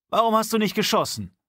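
high, loud angry demand; older man angrily confronts his comrade. A narrator's reading. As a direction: confront him for not firing. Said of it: "fragte Pjotr Andrejewitsch wütend".

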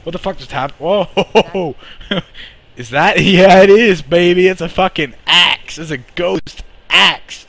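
excited shouting. Real, not acted. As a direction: shout in sheer thrill. Magic axe appears suddenly.

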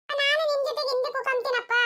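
put-on slow, high kid voice; drawn-out plea of a little child; child's voice. Child asks to sit at the back with father, high child voice.

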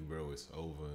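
normal talking voice

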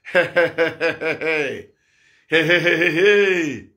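low, repeated cries of dismay; lamenting exclamation. Real, not acted. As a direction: let the repeated heys roll out low and grieving. Preacher lamenting the last days.

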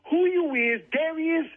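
ordinary talk, nothing marked

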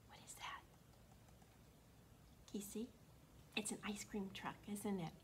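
hushed secret whisper; conspiratorial storytime whisper. Acted. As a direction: lean in and whisper to the kids.

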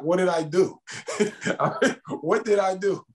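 pitched well up, pleading and half laughing; incredulous high plea. Retold or imitated. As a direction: act baffled, voice climbing high. Repeating the question, rising in disbelief.

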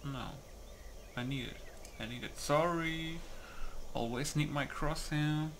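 normal talking voice

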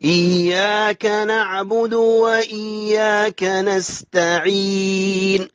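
slow measured chant, held y in إياك; doubled ya. Shown as a demonstration. Teacher models the verse, slow chant with doubled y in إياك. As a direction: recite slowly, doubling the y of إياك. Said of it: "هي مشددة".

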